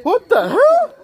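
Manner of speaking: high, loud disbelief; shocked, rising what the hell; surprised. Real, not acted. Surprised by friend's feat.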